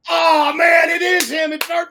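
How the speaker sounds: high, loud thrilled exclamation; a boy realising he has just met Darth Vader; awestruck kid. Retold or imitated. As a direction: squeal it like a starstruck kid.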